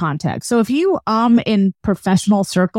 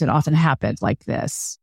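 low creaky vocal fry at the phrase end; 4 semitones lower, 5 dB quieter than usual. Shown as a demonstration. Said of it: "Now, I'm overdoing it there".

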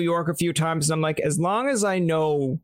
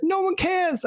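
much higher dismissive yell; mock New Yorker yell; slower than usual. Imagined New Yorker yelling back at a bragger, high dismissive. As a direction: shout it off like you couldn't care less.

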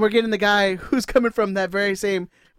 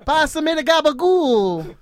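loud, high, drawn-out caricature Italian; mock Italian accent; 8 semitones higher, 7 dB louder, slower than usual. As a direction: belt it out as a hammed-up Italian. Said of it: "That's Italian, right?".

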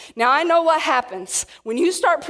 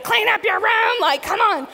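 high, loud, harried hostess yelling at the household; preacher voicing Martha's frantic kitchen shouting; frazzled mock scream; 7 semitones higher, 4 dB louder than usual. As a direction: play the stressed-out host barking orders at everyone. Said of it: "screaming at everybody".

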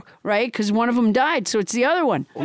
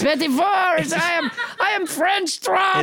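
French Trump announcing himself in mock French; hammed-up French accent; 5 semitones higher than usual. French Trump introduces himself to the show, hammed-up French accent. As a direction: declare it in a hammy French accent. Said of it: "with a French accent".

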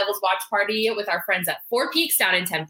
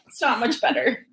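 teary laugh; 7 semitones higher than usual. Real, not acted. Teary laughing after emotional surprise.

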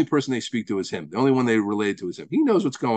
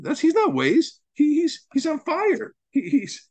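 high, ringing sermon voice pitched up; fervent preaching; 10 semitones higher than usual. Acted. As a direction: preach it with rising fire.

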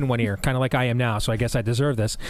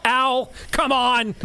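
exasperated librarian; 9 semitones higher, much slower than usual. Retold or imitated. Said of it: "she says".

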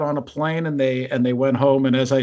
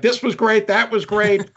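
mock rave review; 6 semitones higher than usual. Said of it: "We're like".

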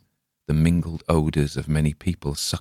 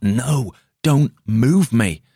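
higher, louder, slow desperate plea; pleading; 7 semitones higher, 5 dB louder, much slower than usual. A narrator's reading. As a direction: beg them not to touch you. Injured man begs not be moved.

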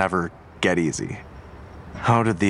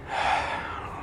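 weary sigh; 7 dB quieter than usual. Acted. Detective steeling himself at the scene.

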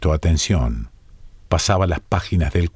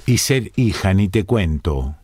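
usual speaking voice

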